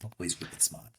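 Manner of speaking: put-on quiet whispered Boston accent quote. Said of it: "that was Ben Affleck in".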